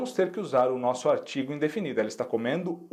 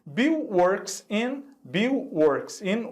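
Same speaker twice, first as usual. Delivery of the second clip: slow read-aloud prompt; 5 semitones higher, much slower than usual. Shown as a demonstration. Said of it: "Olha só, letter A".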